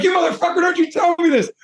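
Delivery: high-pitched indignant outburst; indignant retort, pitched very high; mock outrage. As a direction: fire back in mock fury, voice shooting way up. Acted angry retort to brother's message.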